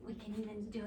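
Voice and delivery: whispered aside; just breathe it under the barking. Real, not acted. Teacher whispering to the kids over the barking. Aside during dog sounds.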